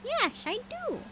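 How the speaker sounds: very high, slow mynah bird voice. A narrator's reading. Mynah coaxing its owner, high bird voice. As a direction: chirp it out like a talking bird. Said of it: "replied the bird".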